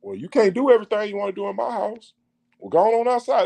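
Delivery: high scolding parent voice; scolding God. Retold or imitated. God putting his child out of the house, high scolding. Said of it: "when God be like".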